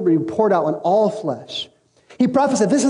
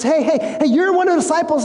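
high, excited mock call-out; single someone out as if recognizing them; acted accusation; 9 semitones higher, faster than usual. Second bystander accuses Peter.

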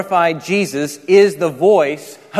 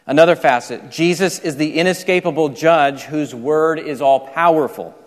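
usual speaking voice

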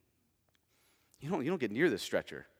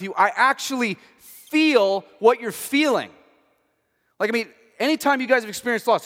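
louder, higher, driving sermon voice; full-force preaching; 8 semitones higher, 11 dB louder than usual. Acted. Pastor urging his church, louder and higher preaching. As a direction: preach it out to the congregation.

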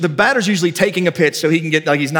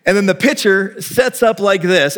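usual speaking voice

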